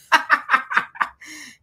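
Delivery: sudden high laugh; hearty laugh. Real, not acted. Laughs at a comment.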